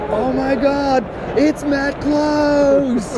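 high, drawn-out mock yell; high-pitched hollered greeting, acted out; retold yell. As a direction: imitate an over-the-top excited yell, stretching the name. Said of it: "yelled at the top of his voice".

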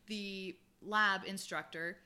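usual speaking voice

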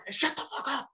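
very high, strained yell, not full volume; held-back, high-pitched pretend scream; mock yell. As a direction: imitate a dad snapping at his kids. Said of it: "he's just yelling at them".

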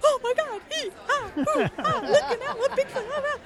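very high, giddy squeals and laughs; hammed-up Bigfoot excitement; flipping out. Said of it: "I'm, like, flipping out".

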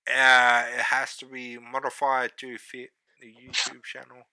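upbeat plug for the channel; promo outro read. Acted. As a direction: read the socials like an announcer.

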